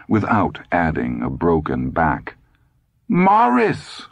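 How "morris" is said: high-pitched wifely cry of his name; high, scolding call. A narrator's reading. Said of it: "Lydia cried".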